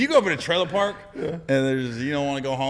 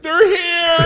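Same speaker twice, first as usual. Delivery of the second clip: high, loud alarmed shout with a laugh; panicked shout; 11 dB louder than usual. Retold or imitated. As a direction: yell the alarm as the bus arrives. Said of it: "he was like".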